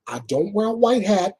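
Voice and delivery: usual speaking voice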